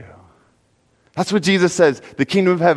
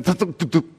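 imitated thunks; 3 dB quieter than usual. Shovel hits buried treasure.

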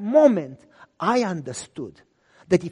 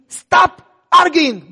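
loud, slow, higher stop order; firm cutoff; 9 semitones higher, 12 dB louder, much slower than usual. Retold or imitated. Pastor cutting off the man's objection, loud slow order. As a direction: shut the argument down.

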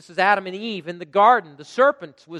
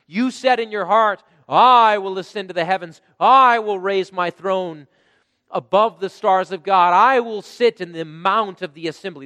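loud preacher's scripture reading; preaching at full force; 2 semitones higher, 6 dB louder than usual. Acted. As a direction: proclaim the fall of Lucifer from the pulpit. Reads Isaiah passage with force.

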